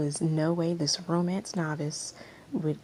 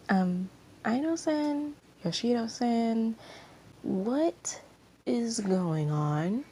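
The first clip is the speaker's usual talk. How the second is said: confused; 6 semitones higher, much slower than usual. Acted. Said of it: "Kyoko was very confused".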